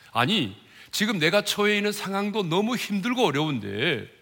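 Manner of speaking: ordinary delivery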